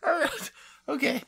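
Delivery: high, still giggling; recovering from laughter. Real, not acted. Co-host trying to compose himself after laughing. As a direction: try to calm down while laughing. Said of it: "I need to compose myself".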